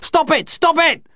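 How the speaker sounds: very high, desperate cry; screamed. A narrator's reading. Child who can't stand the horrible noise, high scream. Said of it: "I screamed out".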